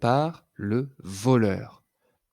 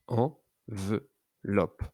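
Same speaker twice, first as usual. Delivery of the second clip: en-ve-loppe, each syllable slow and apart; teacher sounding out syllables, very slow; syllables split slowly; 2 semitones lower, 3 dB quieter, much slower than usual. Shown as a demonstration. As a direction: tap out Enveloppe beat by beat. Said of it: "Combien de syllabes entends-tu?".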